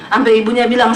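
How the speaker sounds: normal talking voice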